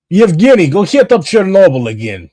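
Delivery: high, loud mock Russian accent; fake Russian accent. Streamer jokingly ordering a comrade back to Chernobyl, fake Russian accent. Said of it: "This fake Russian accent".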